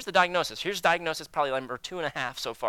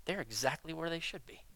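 dismissive scoff; 2 semitones lower, 9 dB quieter than usual. Retold or imitated. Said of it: "looking at someone and go".

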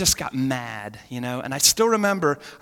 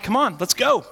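loud, raised mock fight challenge; angry challenge; 8 semitones higher, 5 dB louder than usual. Retelling himself challenging two guys in a truck, angry challenge. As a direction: call them out, high and forceful.

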